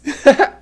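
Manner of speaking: quick high chuckle; brief giggle at yourself; short laugh. Real, not acted. Teacher amused by own high demo, short laugh.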